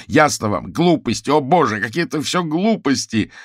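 high, loud exasperated outburst; angry shout. A narrator's reading. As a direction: yell it, pitch shooting right up. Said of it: "Матвей закричал так громко".

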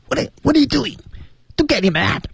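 very high, strangled squeak; squeezed throat voice. Shown as a demonstration. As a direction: squeeze it out through a pinched throat. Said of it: "再把声音换到这个位置的时候".

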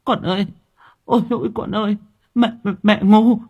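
the mother begs her ghost daughter for mercy; wailing plea. Acted. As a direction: throw yourself down and beg forgiveness. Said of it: "bà Thoa rú lên".

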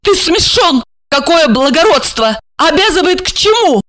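high, derisive interruption; high, snorting contempt; rude scoffing. A narrator's reading. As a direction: scoff at them in a high-pitched voice. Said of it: "фыркнула и грубо прервала его".

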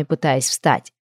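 ordinary delivery, nothing marked

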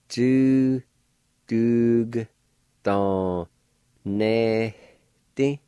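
Du, dug, don, nehti spoken slowly with a faint h before ti; syllables said one by one. Shown as a demonstration. Teacher sounding out Du, dug, don, nehti for learners, syllable by syllable. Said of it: "like this".

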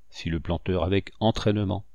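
ordinary delivery

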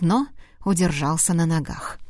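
ordinary delivery, nothing marked

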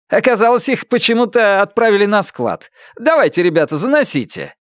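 high-pitched, excusing official; obliging agent. A narrator's reading. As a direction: explain in a high character voice. Agent explains, then directs movers.

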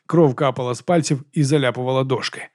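usual speaking voice

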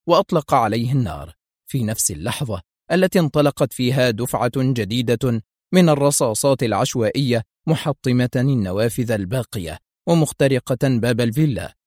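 usual speaking voice

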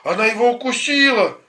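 high, loud alarmed cry; grandmother's shout. Acted. Grandma shouting that the dog bit her grandson, loud high cry. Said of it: "Бабушка кричала".

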